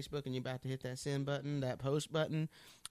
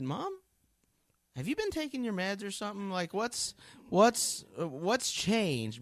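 put-on higher, teasing teen voice; a son teasing his mom, amazed; son's voice; 7 semitones higher, 5 dB louder than usual. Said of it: "and he said".

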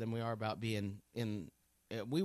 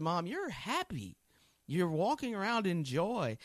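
put-on higher, teasing teen voice; a son teasing his mom, amazed; son's voice; 5 semitones higher than usual. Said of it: "he said".

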